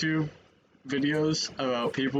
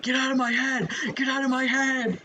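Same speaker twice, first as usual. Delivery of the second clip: hammed-up, high-pitched cry; mock panicked yell; 12 semitones higher, 3 dB louder than usual. Streamer imagining a voice in the head, mock panicked yell. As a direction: play-act shooing out a voice, high and loud. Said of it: "I'd be like".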